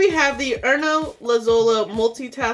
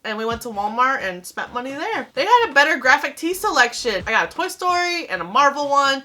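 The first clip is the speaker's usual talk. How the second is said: ordinary talk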